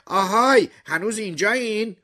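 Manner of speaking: loud hail, then high questioning call. A narrator's reading. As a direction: check through a door if anyone is there. Trapped woman calls through garage door. (صدا زدن بلند و زیر از پشت در)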